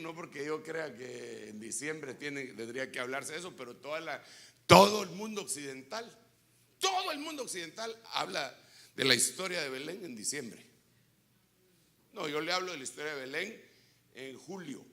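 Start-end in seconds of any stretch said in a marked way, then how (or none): 4.7-10.6 loud emphatic preacher line; preaching emphasis. Real, not acted. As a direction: drive the point home loudly. Énfasis predicador. (énfasis fuerte de predicador)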